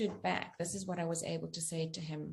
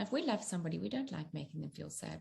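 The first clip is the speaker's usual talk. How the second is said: usual speaking voice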